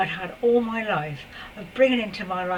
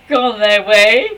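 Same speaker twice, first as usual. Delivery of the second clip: laughing; 14 dB louder, slower than usual. Real, not acted. Laughing about men who left.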